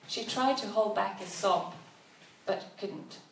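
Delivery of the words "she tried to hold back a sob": ordinary delivery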